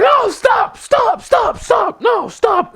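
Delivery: shouting stop. Retold or imitated. Imitating the wrestler shouting at assistants to stop, panicked yell. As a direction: yell at them to stop the cart. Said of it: "Sammy shouted".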